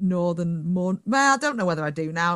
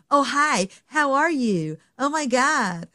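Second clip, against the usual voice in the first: higher, louder gushing American; mock American accent; 6 semitones higher, 4 dB louder, slower than usual. British guest mimicking how North Americans greet him, mock American accent. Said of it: "an American or a Canadian starts talking".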